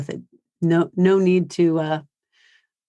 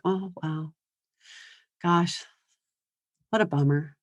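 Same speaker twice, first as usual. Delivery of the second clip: brain-dead empathy; 6 dB quieter than usual. Shown as a demonstration. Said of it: "just go brain dead".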